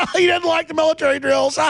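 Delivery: high, hammed-up jeer through laughter; mocking laughter. Comedians ridiculing his drill claims, mock laughing. Imitates hosts laughing at him.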